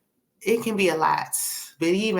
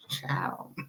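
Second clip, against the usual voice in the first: whispered aside; 3 semitones lower, 10 dB quieter than usual. Real, not acted. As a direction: drop to a whisper, amused. Whispered aside after laughing.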